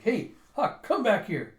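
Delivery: high, loud call after someone. Retold or imitated. Girl calls Huck back as he leaves, calling out. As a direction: holler it as an indignant summons.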